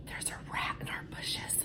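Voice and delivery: breathy secret whisper, barely audible; son's whisper. Retold or imitated. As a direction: whisper it so the neighbors can't hear. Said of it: "thank you for not yelling that".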